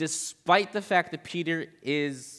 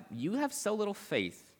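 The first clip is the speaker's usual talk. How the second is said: slow, higher, softer reproach; possibly sassy; 7 semitones higher, 5 dB quieter, slower than usual. Retold or imitated. Jesus to Peter after he sinks, lightly sassy. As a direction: draw it out high and soft, lightly sassy. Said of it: "that could be really sassy".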